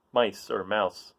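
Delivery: ordinary delivery, nothing marked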